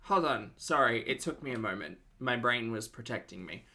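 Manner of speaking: normal talking voice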